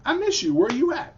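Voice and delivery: lightly voiced friend reaching out; a hint of Siobhan. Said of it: "as in".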